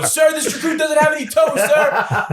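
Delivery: very high, shouted recruit reply; recruit's shout. Retold or imitated. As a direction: sound off like a recruit at attention. Recruit shouts back at instructor.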